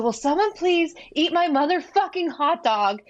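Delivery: high-pitched mock yell; retold scream. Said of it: "So I screamed that exact words".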